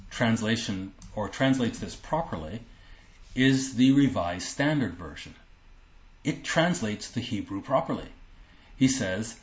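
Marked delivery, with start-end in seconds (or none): none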